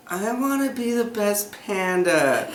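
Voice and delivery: high, drawn-out whine of a sleep talker; wife talking in sleep. Retold or imitated. Husband mimicking his wife waking him at night, high drawn-out voice. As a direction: imitate her with a long, high-pitched, slow plea. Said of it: "She's like".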